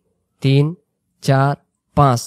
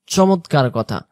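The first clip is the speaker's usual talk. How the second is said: ordinary talk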